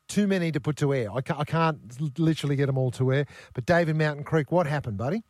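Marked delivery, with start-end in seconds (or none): none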